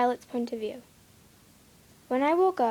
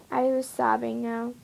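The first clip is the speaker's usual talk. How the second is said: ordinary delivery, nothing marked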